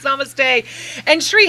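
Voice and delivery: usual speaking voice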